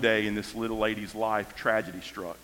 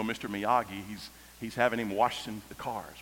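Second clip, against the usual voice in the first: normal talking voice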